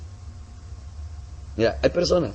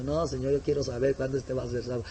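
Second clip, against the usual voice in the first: quiet pleading prayer; 8 dB quieter than usual. Retold or imitated. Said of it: "Y digo".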